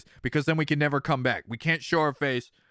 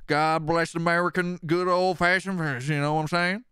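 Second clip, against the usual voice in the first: put-on Southern accent; 3 semitones lower than usual. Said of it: "can do stupid s-, Southernese".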